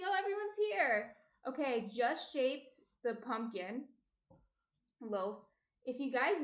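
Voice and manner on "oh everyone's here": delighted to see the chat filling up; excited. Real, not acted. Streamer greets arriving chat, bright and excited. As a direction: pitch jumps up with a happy burst.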